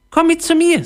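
very high, eager mouse voice; squeaky little voice. A narrator's reading. Mouse inviting her new friend home, high squeaky voice. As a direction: pipe up high like a tiny mouse. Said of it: "ein piepsendes Stimmchen".

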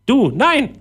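very high parrot comeback. A narrator's reading. Parrot contradicts Philipp, high squawky voice. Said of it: "sagte der Papagei".

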